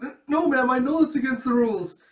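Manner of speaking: high, loud frightened admission; acted terrified schoolkid; nervous stammer. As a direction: stammer out a panicky confession. Scared student admits the offence.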